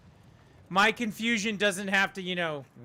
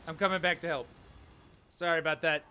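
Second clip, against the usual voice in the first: ordinary talk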